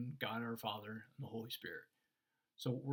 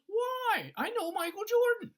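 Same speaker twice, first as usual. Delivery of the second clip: very high, loud whine of disbelief; acted exasperation, shouted high; outraged protest; 9 dB louder than usual. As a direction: cry out at being refused entry. Rejected fan protests to bouncer.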